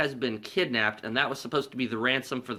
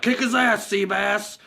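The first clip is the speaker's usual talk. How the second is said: higher, louder, egging on; cheering; 8 semitones higher, 5 dB louder, slower than usual. Retold or imitated. Diner guys urging Seabass, rowdy cheer. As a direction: cheer the big guy on.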